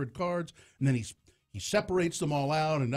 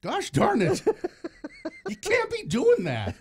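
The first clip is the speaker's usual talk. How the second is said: very high, louder scandalised senator voice; Orrin Hatch; 5 dB louder than usual. Pretend. Guest scolding the trick as a senator, high outraged impression. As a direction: squawk in mock outrage, much higher. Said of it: "try Orrin Hatch".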